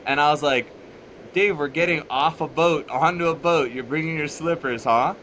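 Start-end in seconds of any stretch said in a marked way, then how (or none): 1.3-5.1 higher, louder teasing of a friend; mock-questioning a buddy; joking teasing. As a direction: rib him with a raised, playful pitch. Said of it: "I was joking with him".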